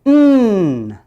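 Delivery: the m sound shown clearly; m sound demo. Shown as a demonstration. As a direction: hold a firm closed-lip hum.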